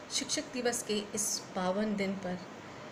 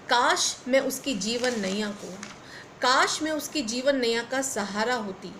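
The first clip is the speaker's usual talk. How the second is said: measured, phrase-by-phrase verse delivery; poem recitation; 3 semitones higher, 4 dB louder than usual. Acted. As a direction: declaim a poem with swelling emphasis. Recites her own poem.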